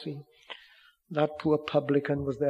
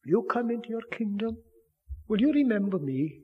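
higher, quick urgent plea; pleading thief; 7 semitones higher than usual. Pretend. Penitent thief on the cross, higher urgent plea. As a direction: plead like a dying man. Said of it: "that penitent thief".

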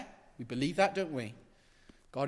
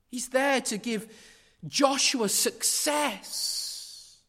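impassioned preaching; 8 semitones higher, 4 dB louder than usual. Acted. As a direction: lift them up with each line. Rising preaching build.

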